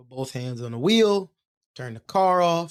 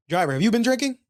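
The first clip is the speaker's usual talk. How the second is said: raised, louder cop voice, higher; stern cop; 9 semitones higher, 6 dB louder, faster than usual. Retold or imitated. Cop questions the driver at the traffic stop, raised and stern. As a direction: bark it like a suspicious cop.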